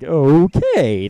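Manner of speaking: weirded-out okay. Acted. Goombala reacts to Koops leaving, weirded-out okay. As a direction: stretch it upward in mock bafflement.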